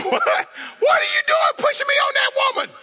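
high, laughing outraged shout; Haman shouting. Acted.

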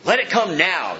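loud, high taunting demand that then eases; scornful mocking. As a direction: jeer like a scoffer calling for proof. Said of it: "they say, scorning him, mocking him".

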